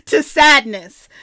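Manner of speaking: ordinary talk, nothing marked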